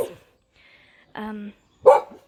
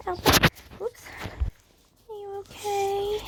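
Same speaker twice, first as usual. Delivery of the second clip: pet talk; 10 semitones higher than usual. Real, not acted. Fussing over the dog.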